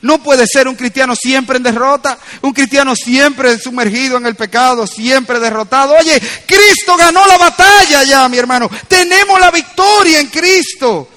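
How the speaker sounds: building to a loud, high proclamation; impassioned pulpit climax, slowing down; forceful preaching. Real, not acted. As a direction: proclaim victory to the congregation.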